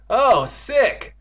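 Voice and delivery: high, loud grossed-out cry; disgusted exclamation. Acted. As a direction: exclaim in revulsion, high-pitched. Character reacts in disgust.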